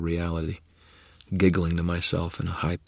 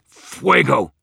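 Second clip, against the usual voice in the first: loud snarled incantation, pitched up; snarled spell; 9 semitones higher, 10 dB louder than usual. Acted. Wizard snarling a fire spell at the dark curse. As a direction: hurl the spell word out. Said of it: "I snarled".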